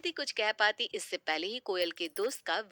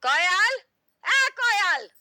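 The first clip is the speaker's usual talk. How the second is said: angry shout, much higher and louder; angry calling out; 12 semitones higher, 9 dB louder, much slower than usual. Acted. Angry mother calls her daughter Koyal from the kitchen, shouting high and loud. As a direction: yell the daughter's name furiously across the house. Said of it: "Sharda जी गुस्से में कोयल को आवाज लगाती है".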